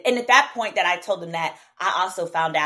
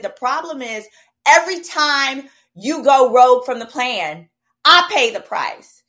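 retold angry rant; 4 semitones higher, 7 dB louder than usual. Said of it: "I said".

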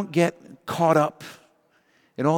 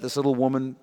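ordinary talk, nothing marked

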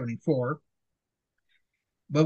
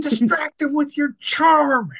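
higher, louder, slow and grand; theatrical command; 9 semitones higher, 5 dB louder, slower than usual. Pretend. Urging her to charm Booth, slow hammed-up proclamation. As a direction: declaim it like a stage villain.

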